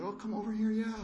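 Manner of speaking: a lightly voiced old teacher waving him over; teacher's greeting. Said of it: "the teacher came in and he said".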